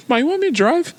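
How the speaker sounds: high mock-innocent question; squeaky, overly casual offer; high-pitched ask. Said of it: "I was like".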